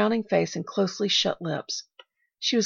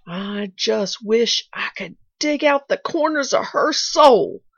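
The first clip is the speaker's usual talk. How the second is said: fierce, slow and louder, rising on soul; a maid grinding out a grudge against her mistress; jerky mutter; 4 semitones higher, 6 dB louder, slower than usual. A narrator's reading. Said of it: "she muttered jerkily".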